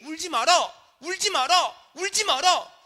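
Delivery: high, loud, emphatic command; forceful preached words of Jesus; powerful Jesus. Retold or imitated. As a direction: proclaim each repetition with authority. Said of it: "주시는 말씀에는 능력이 있는 줄로".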